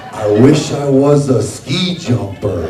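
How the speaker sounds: loud and slow; mock dreamy wish. Him imagining himself as a ski jumper, loud and slow.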